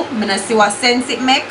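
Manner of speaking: ordinary talk, nothing marked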